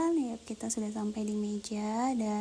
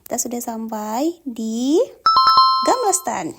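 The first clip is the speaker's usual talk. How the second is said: excited announcement; 11 dB louder than usual. Real, not acted. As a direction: call out the place name with excitement.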